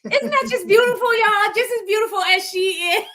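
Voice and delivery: high, loud and gushing over "beautiful"; gushing delight. Real, not acted. Host gushing to the guest about her beautiful name, high and delighted. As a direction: rave about it like you can't contain it.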